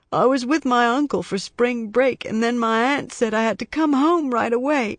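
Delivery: put-on a worried little boy explaining himself; young boy voice. A narrator's reading. Boy tells Scarpetta why he flew home, anxious.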